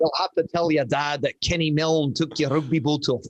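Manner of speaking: amateur imitation of a Scottish accent; horrible Scottish accent. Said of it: "I'm gonna do a horrible Scottish accent".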